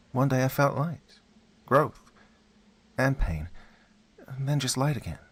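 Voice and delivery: put-on a child remembering the light; young boy voice. A narrator's reading. Son recalls growth and pain.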